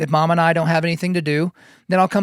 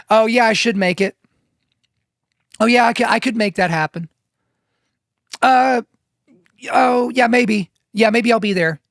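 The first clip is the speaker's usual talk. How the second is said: higher-pitched, hedging mock replies; noncommittal; 4 semitones higher than usual. Friend dodging a Friday invitation, noncommittal. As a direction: waver and dodge a straight answer. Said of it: "all that meandering".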